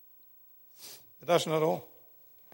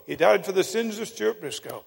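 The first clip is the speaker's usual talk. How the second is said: normal talking voice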